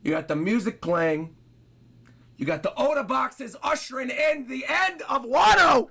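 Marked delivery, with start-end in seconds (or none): 2.4-5.9 loud, higher hype shout; excited shout. Real, not acted. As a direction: yell it out, thrilled by the finale. Hyped by the chapter ending.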